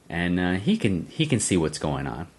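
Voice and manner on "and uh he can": usual speaking voice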